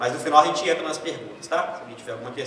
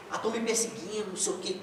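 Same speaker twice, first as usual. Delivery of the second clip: softer whiny mimicry with a rising "tão"; mock paranoid complaint; 11 dB quieter than usual. Preacher repeats the paranoid churchgoer's complaint, soft whiny mimicry.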